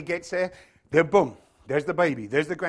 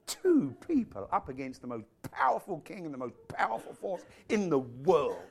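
ordinary talk

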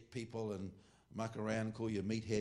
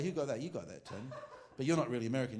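ordinary talk, nothing marked

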